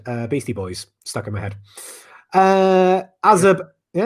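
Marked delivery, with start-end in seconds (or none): 2.3-4.0 excited call. Real, not acted. Announcing the next name.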